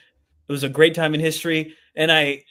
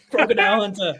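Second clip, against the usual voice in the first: higher mock-Australian through laughter; giggly put-on Australian; Australian accent; 6 semitones higher than usual. Shown as a demonstration. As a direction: do a jokey Aussie, nearly cracking up. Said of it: "I might be a little Australian".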